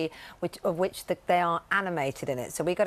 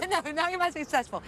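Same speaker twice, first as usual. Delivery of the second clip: laughing; 9 semitones higher, faster than usual. Real, not acted. Laughing reply.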